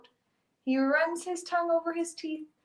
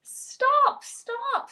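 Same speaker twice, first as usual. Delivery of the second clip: high, desperate screams; high-pitched pleading yelps; screamed; 11 semitones higher, 5 dB louder than usual. A narrator's reading. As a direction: cry it out sharply, pitched way up. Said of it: "scream Old Woman and all the animals".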